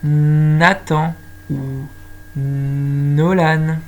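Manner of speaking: "Nathan" and "Nolan" with a long held n at the start; "Nathan ou Nolan" with both first letters stretched; stressed initial sound. Shown as a demonstration. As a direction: slowly hum into each name, Nnnathan, Nnnolan. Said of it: "Comme par exemple".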